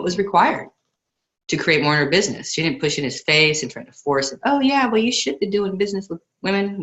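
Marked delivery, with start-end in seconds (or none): 4.4-6.8 playfully mock a pushy Southern businesswoman in a Southern accent. Host mocking a pushy businesswoman, in a playful Southern accent. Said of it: "I did a Southern accent".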